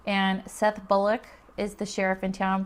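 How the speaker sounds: ordinary talk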